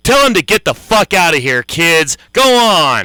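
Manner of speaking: loud hype shout. Acted. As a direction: yell it out to the crowd. Egging on a shouting crowd.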